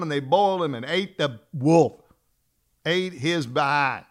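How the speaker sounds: a storyteller building to the punchline; story narration. A narrator's reading. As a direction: tell the ending like a bedtime thriller. Dramatic retelling of the story ending.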